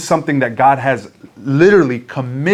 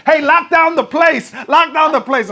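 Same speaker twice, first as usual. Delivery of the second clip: much higher, shouted alarm; urgent shout; 13 semitones higher than usual. Retold or imitated. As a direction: shout the order to seal the mall. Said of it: "a lady said".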